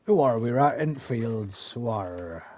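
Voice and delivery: slow, hammed-up Cornish farmer burr; West Country accent. Shown as a demonstration. Streamer demonstrating regional accents, slow mock West Country. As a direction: draw it out in broad mock Devon. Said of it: "Devon and Cornwall, which is more like".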